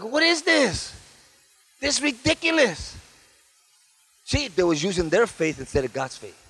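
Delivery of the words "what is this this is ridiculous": high, exasperated disbelief; incredulous. Retold or imitated. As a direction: act out being appalled, pitch up. Retells disbelief at the scene.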